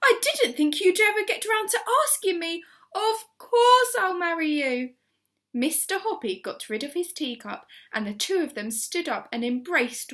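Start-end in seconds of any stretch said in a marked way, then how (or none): 0.0-4.9 high, loud happy accept; joyful cry. A narrator's reading. Missus Silver accepting the proposal, high and loud. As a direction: gush your yes. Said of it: "she cried".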